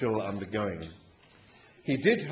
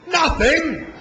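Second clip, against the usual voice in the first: much higher, very loud roar; furious roar; 9 dB louder than usual. A narrator's reading. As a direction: explode on the word in outrage. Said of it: "roared the battle machine".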